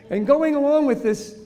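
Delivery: usual speaking voice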